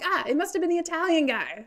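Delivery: high, loud mock deduction; hammed-up eureka; acted accusation. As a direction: blurt it out like a triumphant accuser.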